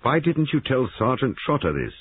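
mildly angry demand; sharp. A narrator's reading. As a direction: challenge her sharply. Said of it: "Giles said sharply".

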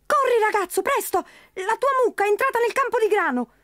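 high squeaky voice faking alarm; tiny creature calling out urgently; urgent leprechaun. Acted. Leprechaun tricking Pat about the cow, high urgent squeak.

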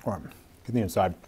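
chewing while talking; words muffled by food; mouth full. Real, not acted. Seen 1: chews from -9 s, mouth still working at 0.1-0.9; burger then hides face.

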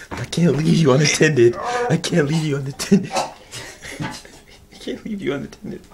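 loud teasing refusal; mock friend while laughing. Real, not acted.

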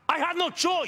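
forceful outcry; defensive shout. Acted. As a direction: shout your justification. Quarrel, defending his actions.

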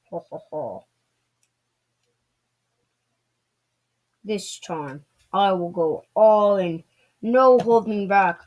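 lightly voiced gloating villain, slow; a hint of a hammy Christmas demon; slow theatrical villain menace. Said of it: "Krampus is too powerful".

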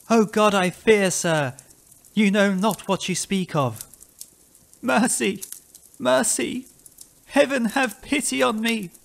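high, fearful cries for mercy; terrified plea. A narrator's reading. Haunted man overwhelmed by fear, high anguished plea. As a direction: cry out to heaven in dread.

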